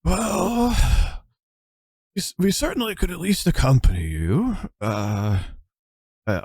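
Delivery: high, slow elder priest; measured, frail old cleric; old man voice. Acted. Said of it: "Elthrill's like".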